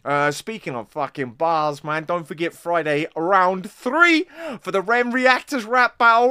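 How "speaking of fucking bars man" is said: ordinary talk, nothing marked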